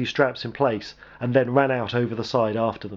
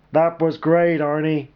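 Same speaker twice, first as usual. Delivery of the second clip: loud, hearty advisor voice; hint of a hearty advisor voice; broad voice; 4 semitones higher, 4 dB louder, slower than usual. A narrator's reading. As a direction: boom the praise from behind the cameras. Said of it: "came a broad voice".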